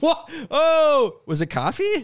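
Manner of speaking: high, loud startled what; surprised. Real, not acted. Hearing of a spit take, high loud surprise. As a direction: jump in shock at the news.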